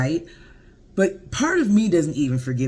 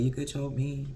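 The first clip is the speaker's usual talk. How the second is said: quiet swoon; 2 semitones lower, 9 dB quieter than usual. Retold or imitated. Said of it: "I was like".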